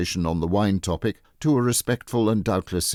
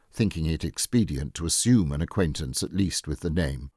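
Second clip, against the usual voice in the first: usual speaking voice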